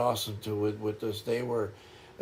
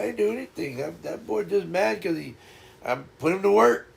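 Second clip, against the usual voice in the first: high, playful imitation of dad teasing; 6 semitones higher than usual. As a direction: mimic a father ribbing his sulking son, pitched up. Dad laughing it off to mom.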